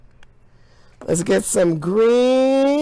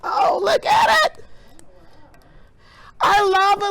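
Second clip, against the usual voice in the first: excited shout; 9 semitones higher than usual. Real, not acted. Lava lamp starts working.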